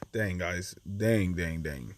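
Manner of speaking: real disappointment trailing away; deflated groan. Real, not acted. Annoyed after losing creature.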